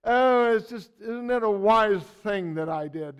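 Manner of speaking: hammed-up British self-congratulation; British accent. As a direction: put on a British accent for a self-satisfied boast. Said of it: "I speak with a British accent".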